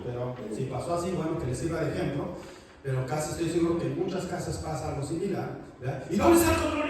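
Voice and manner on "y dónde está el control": high, loud yell for the remote; angry yell. Retold or imitated. Dad yelling at family for the remote, high and loud. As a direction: shout it like an annoyed father. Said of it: "Y empiezan a pelear".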